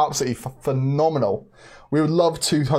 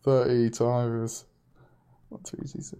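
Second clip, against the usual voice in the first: muttered to self; 5 semitones lower, 7 dB quieter than usual. Real, not acted. Muttering calculation to himself.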